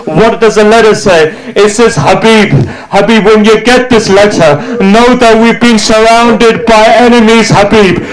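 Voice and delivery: high, soaring majlis lament chant; keen the letter out like a mourning reciter; lament recitation. Acted.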